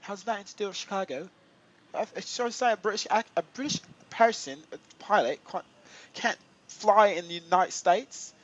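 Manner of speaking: high-pitched, put-on British accent. Streamer defending his right to fly, in a British accent. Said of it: "doing the British accent".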